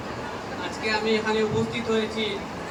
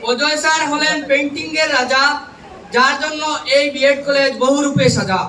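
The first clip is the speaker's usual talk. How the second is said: loud, measured public recitation of verse; poem recitation; 2 semitones higher, 7 dB louder than usual. Acted. Trainee reciting a farewell poem to college teachers, declaimed loudly. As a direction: declaim rhymed tributes line by line, pausing between phrases.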